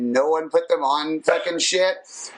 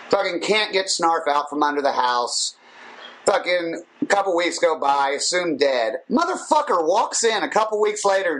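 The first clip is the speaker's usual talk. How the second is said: normal talking voice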